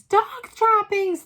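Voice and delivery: Dog's droppings shrieked very high, loud and slow; shouted. A narrator's reading. The whole assembly shrieking the cry, slow and loud. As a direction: screech the dreaded cry. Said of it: "they shouted".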